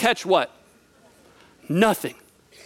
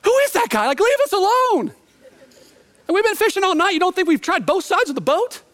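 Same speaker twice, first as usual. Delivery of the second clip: tired fisherman fed up after a night of nothing; annoyed disciple; 10 semitones higher than usual. Retold or imitated. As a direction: grumble at the stranger on the shore. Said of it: "I'm like".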